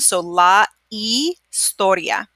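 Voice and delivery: la historia said slowly, no h, starting on ee; slow silent h. Shown as a demonstration. Teacher repeating la historia slowly for students. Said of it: "H is silent".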